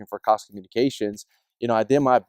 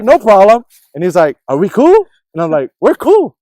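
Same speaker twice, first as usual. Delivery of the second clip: high, loud eager agreement; bright relieved reassurance; relieved and cheerful; 6 semitones higher, 8 dB louder than usual. Retold or imitated. Cable guy making peace with the neighbor, high loud relief.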